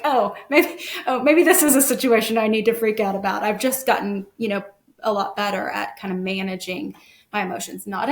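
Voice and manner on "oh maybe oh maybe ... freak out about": joking fake realization, quick and raised; mock sudden realization. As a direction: ham up a sudden "oh wait" moment. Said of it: "I'm like".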